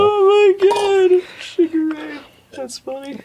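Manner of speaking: giggling. Real, not acted. Laughing at Sugar Ray being called beautiful. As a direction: squeak out the words through laughter.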